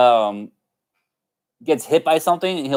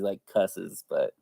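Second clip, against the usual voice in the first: ordinary talk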